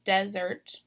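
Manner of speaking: DESert, first syllable stressed; "desert" said DEZ-ert. Shown as a demonstration. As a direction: hit the start of "desert". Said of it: "first syllable is stressed".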